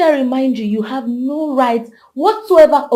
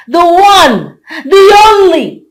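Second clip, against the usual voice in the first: loud, high, drawn-out boast; grand proclamation; 4 semitones higher, 8 dB louder, slower than usual. Acted. New queen boasting to her friend, grand proclamation. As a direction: announce yourself like royalty.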